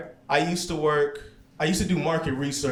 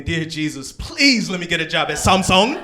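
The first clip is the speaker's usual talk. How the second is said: translated plea; 4 semitones higher, 7 dB louder than usual. Retold or imitated. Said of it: "my attempt at saying".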